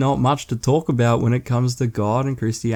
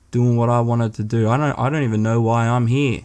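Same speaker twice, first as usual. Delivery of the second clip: ordinary talk, nothing marked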